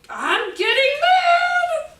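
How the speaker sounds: high, loud, slow shout; yelled feelings. Shown as a demonstration. Child models announcing anger to the teacher, high slow shout. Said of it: "it could be, right".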